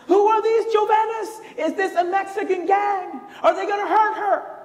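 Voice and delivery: high, loud, overdramatic questioning; mock alarmed professor. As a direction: ham up the professor's panicked questions. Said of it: "And Professor Murphy goes".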